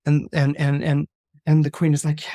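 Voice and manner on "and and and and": ordinary delivery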